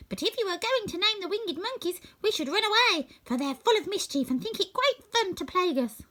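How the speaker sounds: a tiny queen's gracious reply; squeaky mouse queen. Acted. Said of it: "her squeaky little voice".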